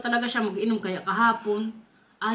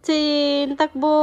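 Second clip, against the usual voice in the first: calling out loudly; 4 semitones higher, 7 dB louder, much slower than usual. Real, not acted. Cheering kids to run.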